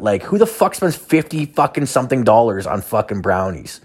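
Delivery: ordinary talk, nothing marked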